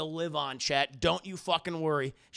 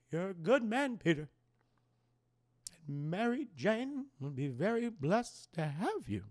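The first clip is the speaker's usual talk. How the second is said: Aunt May voice; 2 semitones higher, 4 dB quieter than usual. Retold or imitated. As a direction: bless him like an old aunt. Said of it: "That's my Aunt May voice".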